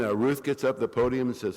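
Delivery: ordinary talk, nothing marked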